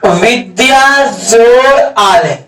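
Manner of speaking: each word drawn out slowly for the class; slow dictation. Shown as a demonstration. Teacher slowly dictating the example to students. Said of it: "जैसे कहेगा".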